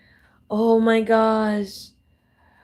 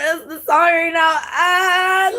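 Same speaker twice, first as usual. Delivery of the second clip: laughing; 8 semitones higher, slower than usual. Real, not acted. Laughing fit over hymn.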